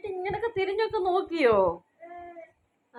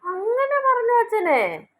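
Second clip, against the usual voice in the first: mock amazement; 6 semitones higher, 6 dB louder than usual. Reacting amazed to a baby's babble.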